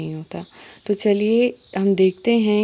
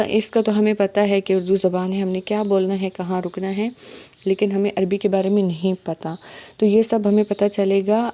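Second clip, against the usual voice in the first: ordinary talk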